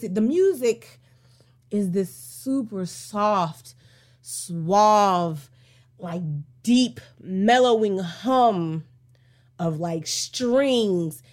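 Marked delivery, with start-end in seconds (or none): 1.7-11.2 slow, lower, savouring each word; mellow and soothing. Acted. Describing the soft string music.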